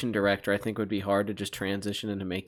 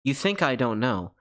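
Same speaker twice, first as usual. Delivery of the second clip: ordinary talk, nothing marked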